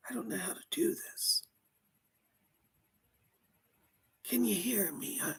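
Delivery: faint breathy whisper through a medium; barely there, struggling to come through; whispering spirit. Pretend.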